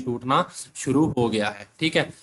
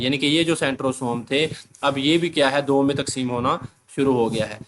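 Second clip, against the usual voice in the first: ordinary talk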